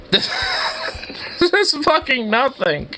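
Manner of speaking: high, breathless laughing words; wheezing laughter. Real, not acted. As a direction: barely get it out for laughing. Laughing at the empty downtown.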